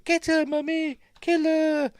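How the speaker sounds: put-on very high, urging child voice; a child egging on its mother, shrill and eager; creepy child voice. Killer's inner child voice.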